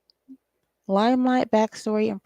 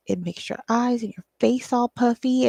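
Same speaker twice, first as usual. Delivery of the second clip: ordinary talk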